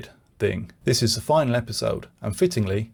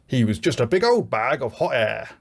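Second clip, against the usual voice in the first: Burke's voice. Retold or imitated. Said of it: "says Burke".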